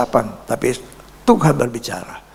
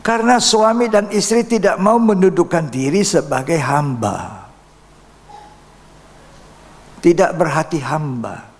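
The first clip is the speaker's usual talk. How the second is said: fiery preaching; 8 semitones higher, 3 dB louder than usual. Acted. Preaching at full force.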